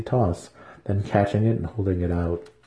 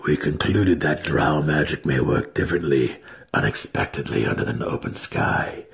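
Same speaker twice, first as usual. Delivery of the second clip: lower, measured drow mage explaining; Alton's voice; 6 semitones lower than usual. A narrator's reading. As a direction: explain it calmly in a lower register.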